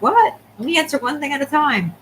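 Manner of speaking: jumps high on What, then a put-on voice; mock confused listener. Viewer imagined as baffled, mock surprised voice. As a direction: play a baffled client asking for one thing at a time. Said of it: "You're probably like".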